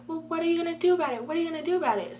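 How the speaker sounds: quick, high-pitched mimicry; challenging classmate. Classmate challenging a reserved student, high taunting imitation. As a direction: rattle off her taunt high. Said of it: "So she's like".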